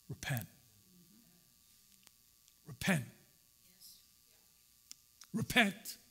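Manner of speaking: soft, low, solemn repetition; solemn repent. Retold or imitated. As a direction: let each repent sink in. Peter's solemn call to repent.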